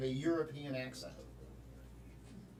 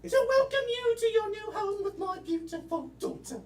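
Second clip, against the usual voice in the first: much higher posh British lady voice; mock British accent; 4 semitones higher than usual. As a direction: play the mother-in-law in a hammed-up British accent. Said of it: "British, French, and German".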